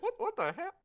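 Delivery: high, indignant squeak; high squeaky outrage. Acted. Patron being kicked out, high squeaky outrage. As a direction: yelp it out in shock.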